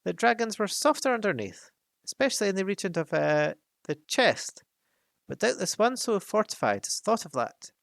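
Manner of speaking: high, squeaky, nervous hobbit voice; frightened squeak. Acted. Bilbo flatters the dragon while fishing for a weak spot, frightened. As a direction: squeak it out, scared but probing. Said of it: "said Bilbo in a frightened squeak".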